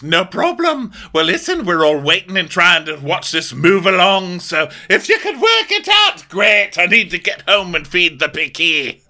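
high, loud mock exasperated voice, laughing at the end; imitating Dorit. Host impersonating Dorit telling everyone to hurry up, high and loud. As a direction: belt it out, fed up and impatient. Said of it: "So Dorit goes".

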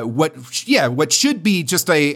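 usual speaking voice

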